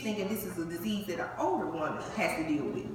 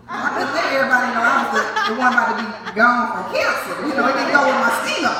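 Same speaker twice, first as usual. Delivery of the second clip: laughing; 9 dB louder than usual. Real, not acted. Laughing about hiding cancer.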